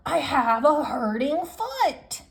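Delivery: mock child shout. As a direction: shout it back like a sulky kid lagging behind. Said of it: "so I had to shout".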